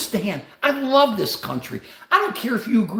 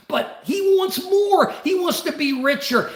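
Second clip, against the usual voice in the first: shout; 8 semitones higher than usual. Real, not acted. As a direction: drag it out and shout it high. Ranting about billionaires.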